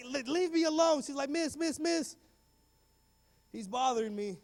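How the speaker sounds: high-pitched mock tattling; complaining schoolgirl. Girl telling teacher he's bothering her, high complaint.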